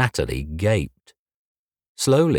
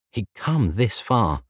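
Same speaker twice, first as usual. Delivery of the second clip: plain reading voice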